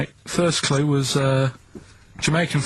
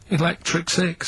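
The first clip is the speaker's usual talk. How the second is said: Electric Six said slowly, Electric leaned on; stressed elect; 4 semitones higher, slower than usual. Shown as a demonstration. Explaining the pun to [name], slow stress on Electric. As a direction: hit Electric to show elect. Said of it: "elec-, elec".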